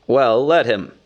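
unmoved man's put-on voice; dismissive Bard. A narrator's reading. Bard answers Bilbo about Thorin, dismissive. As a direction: brush it off firmly. Bard scorns the starving dwarf.

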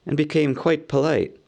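usual speaking voice

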